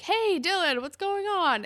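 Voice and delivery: high-pitched mock casual greeting; acted friendly greeting. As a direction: imitate a chummy hallway hello.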